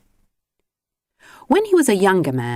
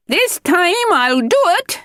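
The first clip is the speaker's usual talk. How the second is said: much higher, louder, slow determined call; 4 dB louder than usual. Acted. Mister Grinling calling to the seagulls, determined and loud. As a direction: call it out over the sea. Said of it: "he called to the seagulls".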